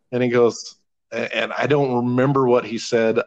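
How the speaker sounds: ordinary talk, nothing marked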